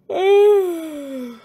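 high, loud theatrical sigh of boredom; long bored sigh. A narrator's reading. Narrator sighs at the listener over boring pi. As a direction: heave a weary sigh at the dull maths.

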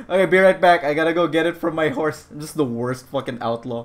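normal talking voice

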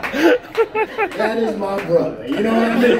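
very slow, high, awed mock emotion. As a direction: savor every word like he's overwhelmed.

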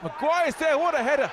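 rising roar as the header goes in; excited goal call. Real, not acted. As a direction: shout the header home. Header goal called live.

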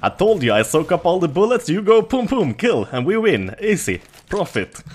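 high, loud, triumphant boast; cocky victory gloat. Real, not acted. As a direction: brag like you planned it all. Gloating after winning the round.

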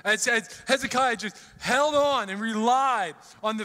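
full-force preaching. Real, not acted. Preacher to congregation, impassioned full-force preaching. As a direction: preach it with rising fire.

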